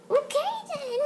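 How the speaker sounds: ordinary delivery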